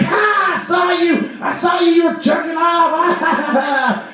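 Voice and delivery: high-pitched mocking holler; gleeful taunt. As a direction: crow it like you caught him red-handed, dragging out the end. Friend's taunt after catching him.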